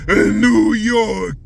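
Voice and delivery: "New York" stretched slow and loud; drawn-out New York accent. Shown as a demonstration. New York accent, shouting the city name out slow. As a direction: lean hard on "New" in a New York drawl. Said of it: "I should use the new New York accent".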